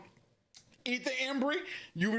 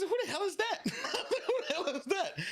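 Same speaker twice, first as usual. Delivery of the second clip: laughing; 10 semitones higher than usual. Retold or imitated. Retelling the crowd reaction, laughing.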